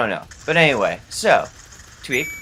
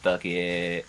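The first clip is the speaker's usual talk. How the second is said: low, soft, drawn-out surrender; resigned mortician; 5 semitones lower, 5 dB quieter than usual. Retold or imitated. Mortician eyes the formaldehyde jar, mock resignation. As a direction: let it drop out lazily, softly giving in.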